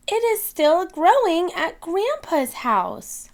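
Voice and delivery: put-on high childlike piggy voice; little piglet voice. Peppa telling her teacher, in a high little-girl voice. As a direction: chirp it up high like a small girl. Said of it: "she says".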